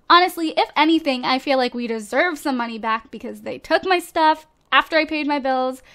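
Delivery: ordinary talk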